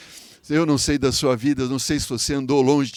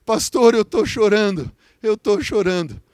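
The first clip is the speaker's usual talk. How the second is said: high, plaintive imitation of a sick man; imitação de choro; 6 semitones higher, 4 dB louder than usual. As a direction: retell his tearful words, raised in pitch. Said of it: "Ele só falava assim". (imitando choro, em voz aguda)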